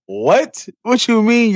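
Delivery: incredulous yelp of surprise; stunned disbelief. Retold or imitated. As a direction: voice shoots up on the last what. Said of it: "I'm like".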